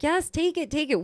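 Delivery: high-pitched retold insistence; acted eager offer; eager urging. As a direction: press it on them, voice way up. Retold group urging boy to accept.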